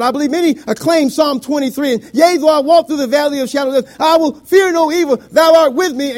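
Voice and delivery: loud, high pulpit proclamation; full-force preaching. Real, not acted. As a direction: proclaim the psalm to the congregation.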